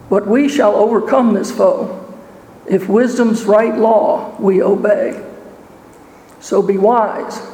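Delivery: ordinary delivery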